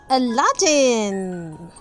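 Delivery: plain reading voice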